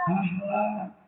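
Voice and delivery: ordinary talk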